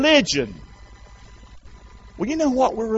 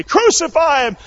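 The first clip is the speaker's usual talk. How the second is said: high, loud mob shout; exceedingly loud cry; 11 semitones higher, 6 dB louder than usual. Acted. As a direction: cry out all the more as the crowd. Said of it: "they cried out the more exceedingly".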